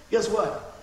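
ordinary delivery

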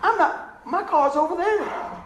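high, loud, laughing at himself. Real, not acted. Preacher laughs retelling walking the wrong way. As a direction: let the laugh take over the words. Laughing at his own confusion.

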